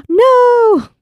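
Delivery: gasp, then a high, loud No; sharp gasp and a cry of no; horrified gasp. Retold or imitated. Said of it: "Jolene is like".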